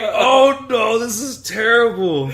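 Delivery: high, loud, laughing dismay; laughing alarm; mock horror. Reacting to the chat's joke, laughing in mock horror. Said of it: "I'm like".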